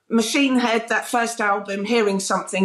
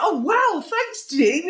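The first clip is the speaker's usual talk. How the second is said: flattered; 8 semitones higher, slower than usual. Retold or imitated. Acting out her flattered reply.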